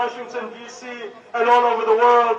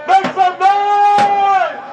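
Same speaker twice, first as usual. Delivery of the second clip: rousing the crowd; 4 semitones higher than usual. Real, not acted. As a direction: call out to get the crowd cheering. Rally leader hypes the crowd.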